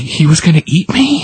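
high-pitched alarmed question; acted alarm. A narrator's reading. Little girl learns she was nearly eaten, acted alarm. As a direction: ask it high, rising in mock disbelief. Voices scared girl overhearing her fate.

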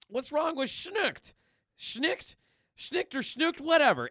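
high-pitched comic protest; put-on Deadpool, pitched well up; mock indignation. Deadpool mocking Logan's noise.

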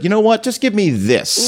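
high-pitched, slowing mock order; mock casual diner. Diner ordering off the cuff, mock breezy demand.

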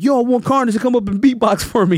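high, projected stage invitation; imitated rapper. Rapper invites storyteller up to beatbox, imitated call-out.

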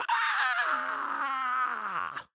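long, piercing falsetto scream held out; goofy sustained rock shriek, very high; high-pitched scream. Shown as a demonstration. Fan demonstrating the band's scream in Ride, mock shriek. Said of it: "Wakened by horrid scream".

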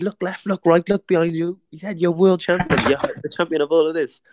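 high, awed mate's voice; imitating his mate. Mate Spider telling the boxer to look around the arena, high and awed. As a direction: sweep it out like his friend, amazed.

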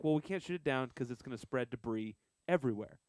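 ordinary delivery

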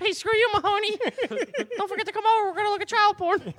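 squeaky mock voice. Mocking Mahoney in squeaky voice.

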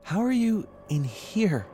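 high, loud, drawn-out question; alarmed. A narrator's reading. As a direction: ask slowly in frightened disbelief. Frightened owner questions the voice.

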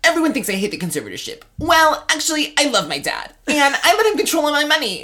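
hammed-up pop star defending her dad. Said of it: "That was pretty good".

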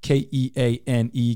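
K E A N E spelled letter by letter. Shown as a demonstration. Host spelling the company name for listeners. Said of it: "Reach out to Keene Landscaping".

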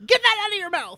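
high scolding shout; angry mom. Retold or imitated. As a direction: yell it like a mad mom. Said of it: "my mom getting really mad at me".

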